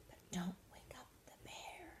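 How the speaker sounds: barely audible warning whisper. A narrator's reading. Hare warns squirrel not to wake the bear, whispered. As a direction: breathe the warning very softly. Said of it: "whispered the hare".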